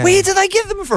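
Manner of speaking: very high-pitched mock incredulity; comic bafflement. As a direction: send the question way up high in disbelief. Comic outrage at English accents.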